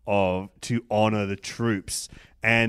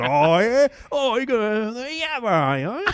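much higher, louder ocker Australian voice, consonants dropped; broad Australian accent; 13 semitones higher, 6 dB louder than usual. Retold or imitated. Mocking the dirty dog Australian accent, laughing at it. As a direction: ham up a thick, grubby bush Australian. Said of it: "dirty dog Australian accent".

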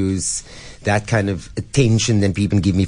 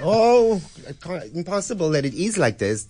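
much higher mock despair; fussy chef impression; 10 semitones higher than usual. S0 mimics Seymour's dramatic despair, higher, hammed-up. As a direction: fret in a squeaky put-on voice. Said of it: "he was like".

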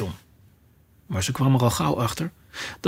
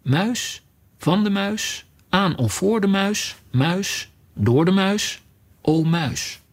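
sing-song schoolroom recitation, pitched up; reciting a declension; 5 semitones higher, slower than usual. A narrator's reading. Alice recalls her brother's Latin grammar and recites it like a lesson.

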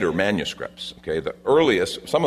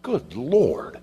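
mildly exasperated, higher-pitched exclamation; frustrated; 4 semitones higher than usual. Retold or imitated. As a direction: imitate a frustrated sigh of words. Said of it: "Jesus is just frustrated".

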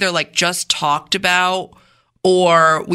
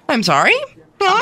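lightly put-on Minnesota accent; 11 semitones higher, 4 dB louder than usual. Questioner puzzled by the salesman's reply, Minnesota accent. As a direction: query it politely in a Minnesota accent. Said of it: "that was your Minnesota accent".

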